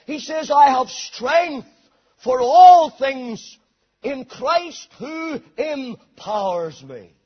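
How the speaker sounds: ringing, rolling sermon delivery; full-force preaching. Real, not acted. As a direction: preach it with building momentum.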